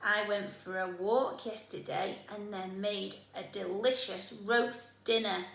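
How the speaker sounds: usual speaking voice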